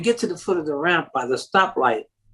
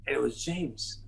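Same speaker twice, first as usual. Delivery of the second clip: ordinary talk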